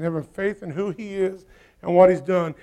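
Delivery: ordinary talk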